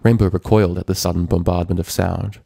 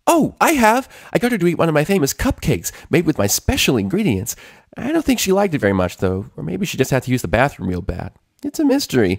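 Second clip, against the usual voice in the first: much higher, bouncy chatter; excited Pinkie; 9 semitones higher than usual. A narrator's reading. Pinkie telling Twilight about the cupcake, excited. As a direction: gush it out cheerfully. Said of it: "Pinkie said excitedly".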